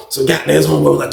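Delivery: ordinary talk, nothing marked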